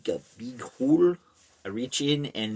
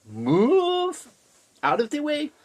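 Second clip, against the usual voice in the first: high, loud herding call; mock shouted order; 6 dB louder than usual. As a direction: holler to clear the path. Man clears path for cows.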